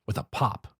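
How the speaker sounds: ordinary delivery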